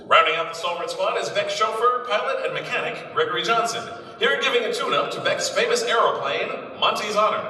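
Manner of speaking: put-on brisk old newsreel narration; peppy vintage announcer read. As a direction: sell it like a 1930s newsreel.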